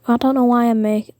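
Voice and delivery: ordinary talk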